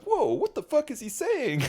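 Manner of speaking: high astonished quote; mock surprise. Man imitating French people baffled by him, high pitched. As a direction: imitate stunned locals. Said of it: "they'll be like".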